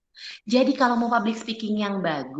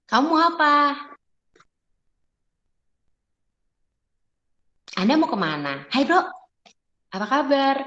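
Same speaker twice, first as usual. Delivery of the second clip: demo greeting; 4 semitones higher than usual. Shown as a demonstration. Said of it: "rasanya digituin gimana".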